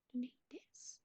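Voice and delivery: breathy whispered question, close and quiet; soft whisper. Real, not acted. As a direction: whisper it softly to the dog.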